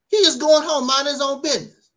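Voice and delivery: outraged outburst. Real, not acted. Outraged defense of the boy.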